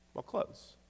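"Well, clothes" breathed almost unvoiced; a wry whispered aside; muttered answer. Real, not acted. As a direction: toss off the obvious answer under your breath. Obvious answer, muttered then repeated.

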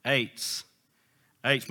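"eights" repeated like a recording; imitated audio pronunciation guide. Preacher replays the volume button's word, lightly mimicked recording. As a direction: lightly mimic a device saying one word. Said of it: "Same thing".

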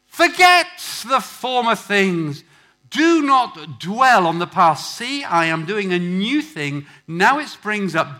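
loud, slow, emphatic declamation; forceful scripture reading. Acted. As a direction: proclaim God's words slowly and loudly.

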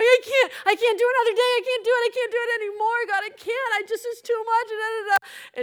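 high, whining wail; hammed-up hysterical protest; overwhelmed meltdown. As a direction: pour it out high and tearful, unable to cope. Reenacts morning meltdown to God.

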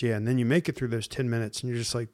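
ordinary talk

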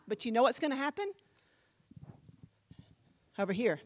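ordinary talk

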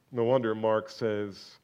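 ordinary talk

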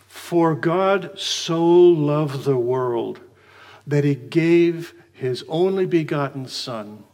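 slow, reverent reading of the verse; solemn scripture reading. Acted. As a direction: let each word of the verse land slowly. Reads the verse aloud, slowly.

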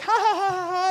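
high-pitched mock laugh; imitated wife's laugh. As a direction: fake a high, staccato ha-ha of amusement. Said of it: "she'd be like".

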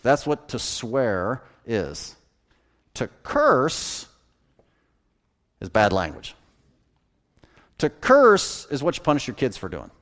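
ordinary delivery

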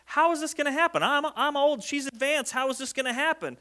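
acted high-pitched doubt. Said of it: "he's asking out of doubt".

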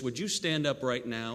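usual speaking voice

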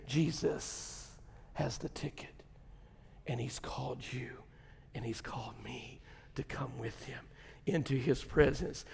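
very soft, low, near-whispered preaching; hushed preaching. Real, not acted. Preacher telling the church they are called, hushed. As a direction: drop to an awed hush.

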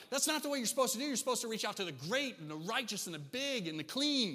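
high indignant objection, pitched well up; mock scandalized crowd. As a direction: play the shocked religious folks. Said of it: "they're like".

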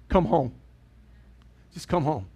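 soft, gentle welcome; quiet loving invitation; tender come home. Pretend. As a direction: say it like a father opening the door. Father's tender welcome to child.

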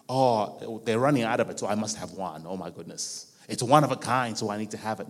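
low mock-panicked grabber; scarcity mindset. Preacher voices someone afraid stock is running out, low mock fretting. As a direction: drop the voice low and imitate someone who must have one. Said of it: "Not an attitude of scarcity".

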